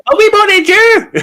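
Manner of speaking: high squeaky mock plea; squeaky mock whine; mock pleading clients.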